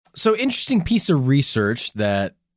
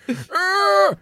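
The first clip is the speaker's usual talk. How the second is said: put-on very high, creaking old-timer voice; exaggerated old geezer, drawn out at the end; eighty-year-old man. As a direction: ramble like a doddering old man. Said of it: "It's like eighty-year-old man just like".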